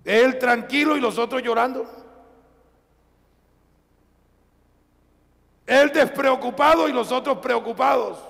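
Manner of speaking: high, loud preacher's cry; ringing sermon climax; full-force preaching. Acted. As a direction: drive the contrast home from the pulpit.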